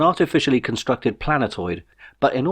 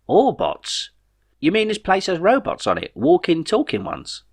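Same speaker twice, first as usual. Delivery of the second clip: higher, bemused everyman voice; Jeff the puzzled friend; 9 semitones higher than usual. A narrator's reading. Jeff asks Ray how the remote works, higher and bemused. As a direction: puzzle it out aloud, a bit lost.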